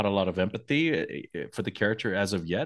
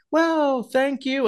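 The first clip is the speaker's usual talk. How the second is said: very high, louder call back; mock yelled reply; 4 dB louder, much slower than usual. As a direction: imitate hollering back from a mile away. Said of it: "They have to yell".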